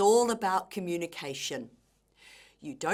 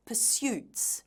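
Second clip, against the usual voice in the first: "pursuits" stressed on "suits", falling at the end; light "pur", strong high "SUITS" in "pursuits"; second-syllable stress; 5 semitones higher than usual. Shown as a demonstration. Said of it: "the stress is on the second syllable".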